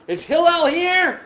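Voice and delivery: loud, high shout through a door; calling out. Acted. Visitor banging on Hillel's door, calling out loudly.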